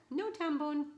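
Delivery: sharp loud "No!" at the cat; calling the cat off. Real, not acted. Scolding her cat away from her water glass, loud and high.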